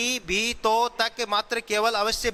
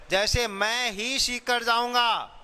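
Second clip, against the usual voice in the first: मैं ही pushed, slow and a little higher; stress on मैं; 4 semitones higher than usual. Shown as a demonstration. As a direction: lean hard on मैं ही. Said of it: "मैं पर जोर प्रदान". (मैं ही पर ज़ोर, धीमे)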